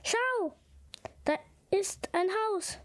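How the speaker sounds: high-pitched storybook call; hedgehog's call, read aloud without shouting. A narrator's reading. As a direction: point it out in a high, lightly acted call. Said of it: "Da rief der Igel".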